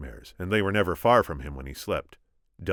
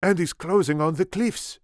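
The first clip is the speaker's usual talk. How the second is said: high, loud character voice; urgent lookout report as the Spaniard; 11 semitones higher, 5 dB louder than usual. A narrator's reading. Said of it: "answered the Spaniard".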